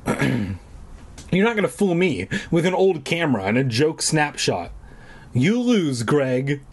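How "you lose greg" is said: ordinary talk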